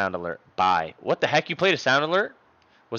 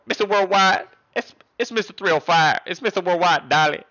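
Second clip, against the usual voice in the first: a light [name] voice, a bit higher and louder; [name] impression; 6 semitones higher, 4 dB louder than usual. Streamer doing [name] for chat, light mock voice. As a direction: hype it up like [name]. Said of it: "your [name] impression".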